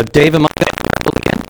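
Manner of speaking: ordinary delivery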